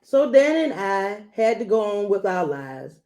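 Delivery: usual speaking voice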